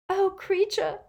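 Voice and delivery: high, pitying wail; tearful wail. A narrator's reading. Girl crying for the elf, pitying wail. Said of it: "wailed Hermione".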